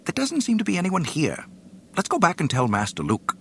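high, fast nervous robot; quick fussy droid. Acted. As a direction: hurry it out, eager to leave. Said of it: "quickly announced".

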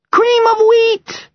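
put-on high, loud, slow child's shout. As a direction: shout it like an eager little girl.

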